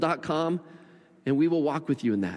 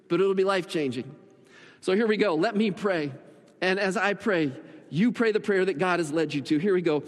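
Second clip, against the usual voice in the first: normal talking voice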